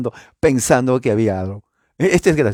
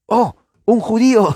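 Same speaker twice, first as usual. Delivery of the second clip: loud, high-pitched cry of discovery; mock exclamation; 11 semitones higher, 5 dB louder than usual. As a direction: exclaim it like a crowd spotting someone.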